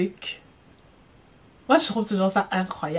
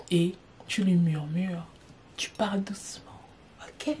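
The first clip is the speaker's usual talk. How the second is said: soft breathy whisper, barely voiced; 4 semitones lower, 8 dB quieter than usual. Shown as a demonstration. Showing a sister how to murmur at dinner, whispered. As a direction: show how to murmur to him. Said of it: "murmure dans son oreille".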